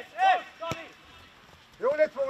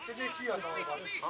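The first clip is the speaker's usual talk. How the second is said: usual speaking voice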